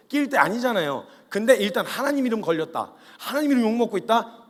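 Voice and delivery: ordinary delivery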